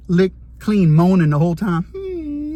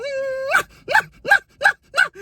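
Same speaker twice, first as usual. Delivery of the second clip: very high, strained, drawn-out moaning hums; rising moan; much slower than usual. Retold or imitated. Cellmate moaning as his hand shakes, a very high strained hum. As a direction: let the moan climb higher and higher.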